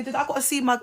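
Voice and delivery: usual speaking voice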